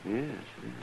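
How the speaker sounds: soft and low, grave; hushed. Acted. Doc confirming it is a dead man, hushed. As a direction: murmur it, shaken.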